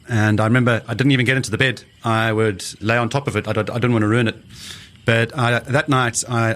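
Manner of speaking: usual speaking voice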